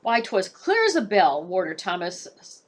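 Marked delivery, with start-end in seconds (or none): 0.0-1.4 louder, amazed; marveling. Acted. Warder marvels at the raven's clear words. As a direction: marvel at the bird.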